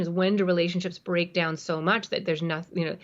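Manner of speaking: ordinary talk, nothing marked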